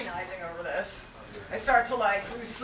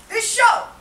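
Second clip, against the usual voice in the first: loud, high comic rant; exasperated outburst; 9 semitones higher, 9 dB louder than usual. Acted. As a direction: shout it out in hammed-up frustration. Exasperated outburst about his clashing show.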